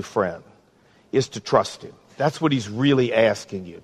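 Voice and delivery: ordinary delivery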